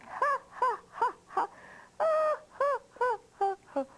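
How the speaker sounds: high, staccato mock laughter; acted bear laugh. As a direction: laugh in high, separate ha-has. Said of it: "he was laughing".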